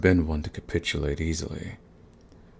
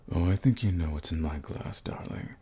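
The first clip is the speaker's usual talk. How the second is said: usual speaking voice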